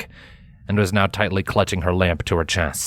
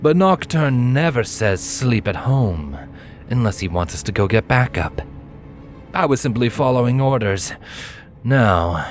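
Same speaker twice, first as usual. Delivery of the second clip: cocky villain voice; 4 semitones higher than usual. A narrator's reading. Silent explains, orders.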